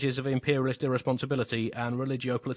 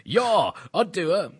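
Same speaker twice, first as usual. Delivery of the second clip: slow, drawn-out leering call, higher and louder; Jacob Zuma; 6 semitones higher, 5 dB louder, much slower than usual. Retold or imitated. Mock Zuma calling out to a passing woman, leering and drawn-out. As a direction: stretch it out like an appreciative catcall. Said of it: "Jacob Zuma says whenever he sees any woman".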